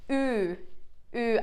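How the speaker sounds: "ü" said from the back with pursed duck-face lips; Turkish ü demo. Shown as a demonstration. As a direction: model "ü" for the class to copy. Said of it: "just try to imitate me".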